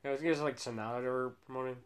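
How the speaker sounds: normal talking voice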